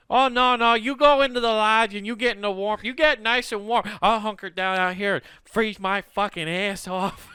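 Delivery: high, loud mock-Midwestern fussing; martyred Midwesterner. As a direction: insist with passive-aggressive self-sacrifice.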